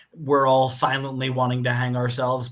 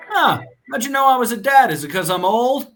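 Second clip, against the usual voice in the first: higher, louder mock surprise; joking owner; 8 semitones higher, 6 dB louder than usual. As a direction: play the owner as playfully teasing. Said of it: "he looks at him and goes".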